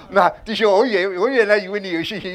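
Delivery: normal talking voice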